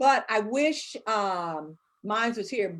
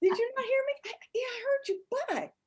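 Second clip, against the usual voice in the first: put-on high, pleading kid voice, much higher; child's whiny voice; 11 semitones higher than usual. Son pestering his mother, high whiny kid voice. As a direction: imitate a little boy pestering mama. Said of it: "and he's like".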